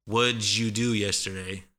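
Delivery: "What'd you" blended into "wudju"; combined sounds. Shown as a demonstration. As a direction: run "What'd you" together as one word, "wudjoo". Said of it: "they all combine".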